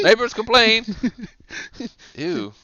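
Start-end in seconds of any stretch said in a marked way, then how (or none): 0.0-0.8 high, loud put-on maid voice; hotel maid complaining. Hotel maid at a stinking room, high mock complaint.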